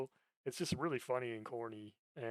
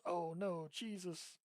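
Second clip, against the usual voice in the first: lightly voiced anime girl, a little higher; a hint of the cutesy phone alert, fading soft; mild anime girl mimic; imitating the anime alert; 5 semitones higher, 5 dB quieter, much slower than usual. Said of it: "with a fucking anime girl just".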